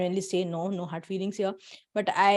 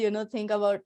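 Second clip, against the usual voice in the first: ordinary talk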